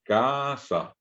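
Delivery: casa with weight on the first syllable CA; teacher demonstrating the stress in casa. Shown as a demonstration. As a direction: lean the voice on the CA of casa. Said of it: "Cargo la voz".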